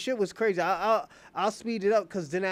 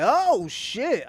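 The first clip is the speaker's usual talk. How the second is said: louder, higher-pitched retold "oh shit" shock; shocked exclamation; 6 semitones higher, 7 dB louder than usual. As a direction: blurt it out, astonished and loud. Retells his shocked reaction.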